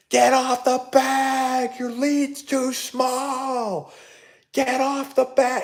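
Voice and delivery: high, drawn-out yell of a heckling grandfather; imitated screaming. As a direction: imitate someone screaming slowly across a ballfield. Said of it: "he'd be, like, screaming".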